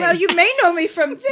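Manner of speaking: high mock self-promo; mock pompous. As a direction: parody an actor listing credits. Mock listing own credits.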